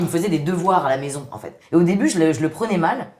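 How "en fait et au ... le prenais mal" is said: ordinary talk, nothing marked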